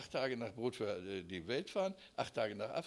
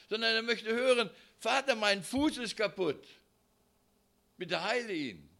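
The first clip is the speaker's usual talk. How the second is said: high, loud pleading sermon; loud preaching; 8 semitones higher, 7 dB louder than usual. Acted. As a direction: urge the congregation. Preaching at full force.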